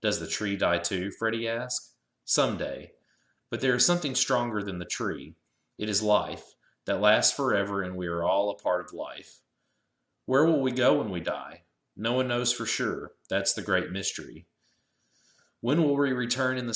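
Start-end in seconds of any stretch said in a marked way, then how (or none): none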